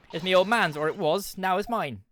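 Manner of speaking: a scrappy urchin claiming the coat as hers now; cockney street urchin. Acted. Street girl insisting the coat was her old man's, cockney.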